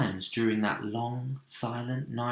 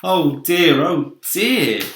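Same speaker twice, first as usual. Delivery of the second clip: fretting; 6 semitones higher, 10 dB louder, slower than usual. Acted. Creature fretting on the peach.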